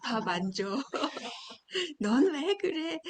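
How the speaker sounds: 웃음 섞인 짜증 난 엄마 흉내; 아이를 다그치는 엄마를 가볍게 흉내. Retold or imitated. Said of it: "라고 할 수 있어요". (짜증 난 엄마 말투를 웃으며 흉내)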